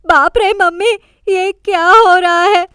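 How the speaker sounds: waking up with a frightened shout; startled cry. A narrator's reading. Mujo wakes to JJ looming over him, startled yell. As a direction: cry out in alarm. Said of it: "जोर से चिल्लाते हुए उठता है".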